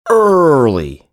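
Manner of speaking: high, loud stretched early; drawn-out groan. Real, not acted. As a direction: moan the word early. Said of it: "You have, like, a morning voice".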